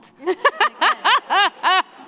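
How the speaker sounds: high loud laugh; real laugh; burst of laughter. Real, not acted. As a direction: crack up at the memory. Laughs at "mop up the blood.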